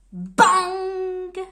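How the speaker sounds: a loud slam of a word; shouted bang. Acted. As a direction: bang it out like a slammed door. Door slam sound word.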